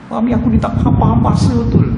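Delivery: imitated exasperated wife; aggrieved wife. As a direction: complain about a clueless husband. Acts aggrieved wife complaining about husband.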